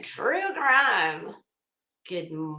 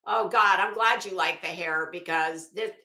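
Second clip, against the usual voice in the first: ordinary delivery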